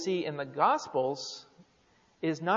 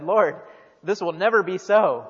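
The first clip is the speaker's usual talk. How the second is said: louder, emphatic mock rebuke; retold rebuke; 7 dB louder than usual. Peter pulls Jesus aside to object, retold as a loud rebuke. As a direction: voice Peter firmly rebuking, with added volume. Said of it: "rebuked Jesus and said".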